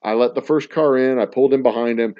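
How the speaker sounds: ordinary talk, nothing marked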